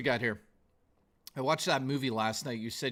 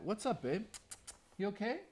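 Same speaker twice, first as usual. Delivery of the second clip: higher and softer, cooing to a pet; soft pet talk; 6 semitones higher, 9 dB quieter than usual. Real, not acted. Streamer checking on his cat, tender higher coo.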